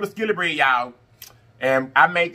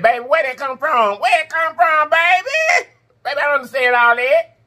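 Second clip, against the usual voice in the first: high, loud, incredulous squeal; baffled auntie outcry; exasperated disbelief; 9 semitones higher, 3 dB louder than usual. Real, not acted. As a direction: ask it like you can't believe it's real. Baffled by modern school subjects.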